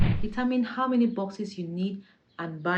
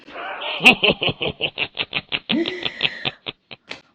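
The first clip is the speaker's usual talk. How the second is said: loud, long burst of laughter; big laugh, held for several seconds; 7 dB louder than usual. Real, not acted. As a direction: laugh out loud at your own joke. Laughs at own joke about beating.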